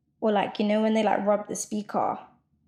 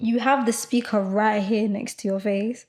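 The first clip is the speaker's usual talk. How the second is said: ordinary talk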